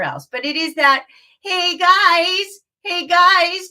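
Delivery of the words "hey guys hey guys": whiny higher hey guys; a complaining child calling. Shown as a demonstration. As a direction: nag like a complaining kid. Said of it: "we're gonna add a complaining child".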